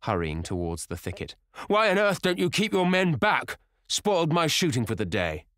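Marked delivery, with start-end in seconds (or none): 1.7-5.4 high, loud scolding, easing a little; angry. A narrator's reading. Sir Geoffrey scolding the keeper over the spoiled shoot, angrily. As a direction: berate him at full voice.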